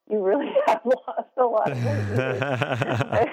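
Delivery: laughing through the words; amused disbelief, laughing. Real, not acted. Amused quote to her mom.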